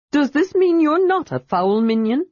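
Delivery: high, bright kender voice; eager kender. A narrator's reading. Kender questions the dragon, high and eager. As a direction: pitch it up like a curious kender. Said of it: "Tas asked".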